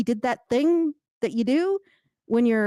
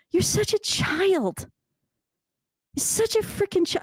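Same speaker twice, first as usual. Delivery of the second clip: exasperated; 9 semitones higher than usual. Retold or imitated. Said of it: "I'm like".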